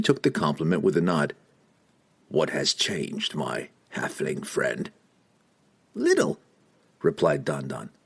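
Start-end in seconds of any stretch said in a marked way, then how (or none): 2.3-4.9 low, soft, slow menace; quiet and cold like a killer; cold assassin voice. Acted. Assassin Entreri speaking. 6.0-6.4 very high squeaky reply; halfling voice. Acted. Don Don replies.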